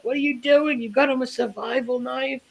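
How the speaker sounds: high mock shocked aunt. Aunt reacting to the knife gift, high mock shock. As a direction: squeal it like the appalled aunt. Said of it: "she's like".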